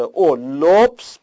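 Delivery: او then لبس, drawn out slowly on the o; slow drawn o sound; stressing the o. Shown as a demonstration. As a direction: stretch the o in لبس. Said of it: "لبس هم اولش او داره".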